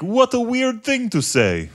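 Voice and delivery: high mock Russian accent; flat, mildly threatening Russian accent; Russian guy. Russian bar customer replying to him, mock Russian accent. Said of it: "the Russian guy was like".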